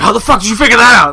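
loud, high, fast imitation of panic; freaked out. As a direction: blurt it as the rattled troll, imitating him. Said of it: "He, like, freaked out".

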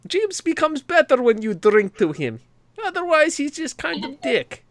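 high, hearty put-on Russian accent. Shown as a demonstration. Streamer as Russian host welcoming viewers, high mock Russian accent. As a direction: welcome them grandly with a thick Russian accent. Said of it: "it would be Russian".

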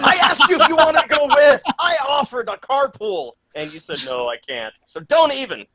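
high, laughing mock indignation; defensive laugh. Real, not acted. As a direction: protest with a laugh, pitch way up. Defensive reply to friend's teasing.

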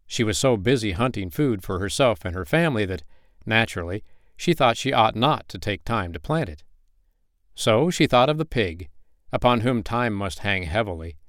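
plain reading voice

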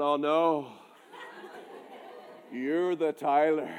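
low, mock-dread murmur; comic low-pitched dismay; sinking realisation. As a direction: let it sink in, voice dropped low. Acts out his dread on realising.

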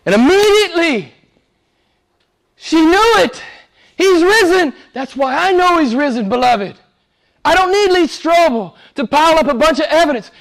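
high, loud impassioned preaching; fiery preaching. Real, not acted. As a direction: preach it with full force. Preaching at full force.

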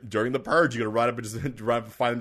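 usual speaking voice

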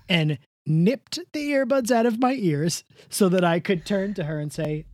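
usual speaking voice

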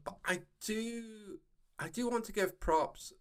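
normal talking voice

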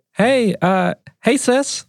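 sheepish brother. Acted. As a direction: poke your head in, a little awkward. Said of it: "He's like".

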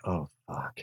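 low, very soft sinking curse; hushed, deflated realisation; dismayed mutter. Retold or imitated. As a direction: mutter it to yourself in dread. Retold dread at wife's summons.

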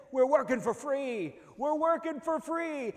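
loud, high, drawn-out protest yell; screaming at top of lungs. Retold or imitated. Worker yelling as the last hog falls, screamed slogan. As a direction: holler it slowly across the plant. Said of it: "I screamed at the top of my lungs".

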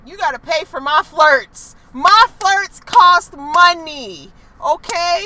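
sassy. Real, not acted. Sassy declaration.

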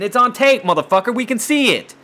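high, loud outburst, calling him out; indignant shout. Real, not acted. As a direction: yell it like it's right there on the tape. Angry retort about [name] tape.

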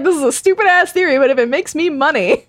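high mock Paul voice, amused; lightly voiced Paul, cheeky. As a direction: play Paul shrugging it off, high. Imitating Paul's money-minded shrug.

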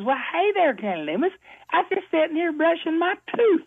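put-on a naive young lady chirping back; young girl voice. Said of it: "And she says".

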